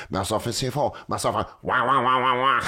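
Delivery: gibberish French Canadian comic, lightly voiced; mock French Canadian babble. Mocking a French Canadian comedian's set, mock French Canadian babble. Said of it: "a French-speaking Canadian comedian, and he goes".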